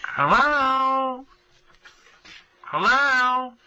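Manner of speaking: high, drawn-out calls of hello into the answering machine; imitating mom calling out for someone to pick up; mother calling hello. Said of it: "It's like".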